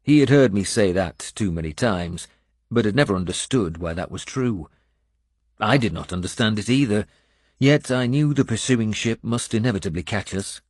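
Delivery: ordinary delivery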